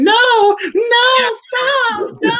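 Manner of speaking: high, loud panicked crying plea. Retold or imitated.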